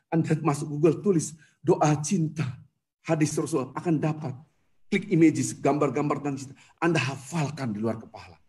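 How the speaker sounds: ordinary talk, nothing marked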